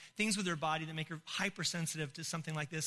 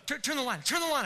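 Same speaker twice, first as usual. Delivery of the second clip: heated demand; 6 semitones higher, faster than usual. Retold or imitated. Wife to sleepy husband at night, heated demand. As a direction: imitate a wife getting heated. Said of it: "kinda got, like, heated".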